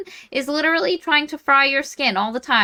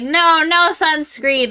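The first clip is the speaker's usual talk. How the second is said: high, slow drawn-out no; mock refusal; 6 semitones higher, 3 dB louder, slower than usual. Pale Olive turning down sunscreen, high mock refusal. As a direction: refuse in a raised, stretched-out voice.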